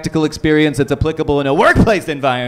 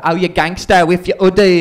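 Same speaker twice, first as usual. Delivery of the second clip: higher prissy English voice; mock English accent; 6 semitones higher than usual. An English person scolding the Canadian's hoodie, in a mock English accent.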